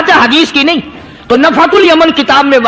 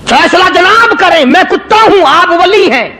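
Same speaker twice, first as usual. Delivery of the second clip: dog's reproach; 6 semitones higher than usual. A narrator's reading. Dog answers the holy man.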